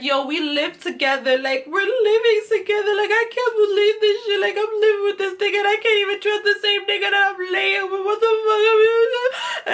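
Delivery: high wailing mock sob, building; imitated crying. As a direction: wail it out, rising into incoherent fake bawling. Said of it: "crying to her like".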